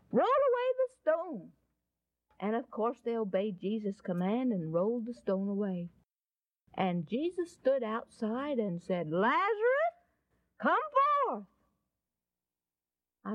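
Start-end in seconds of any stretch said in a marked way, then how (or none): 0.1-1.5 acted solemn command; commanding call. Jesus repeats the command. 9.1-11.5 high, ringing summons with pauses; acted-out commanding summons; calling out. Jesus calls into the tomb.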